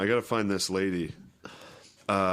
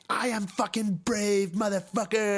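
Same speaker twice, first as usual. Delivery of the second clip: shrill defiant voice; 12 semitones higher than usual. Retold or imitated. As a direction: scream defiance up high. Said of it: "she's like".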